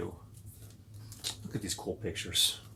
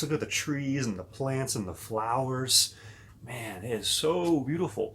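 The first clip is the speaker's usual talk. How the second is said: ordinary delivery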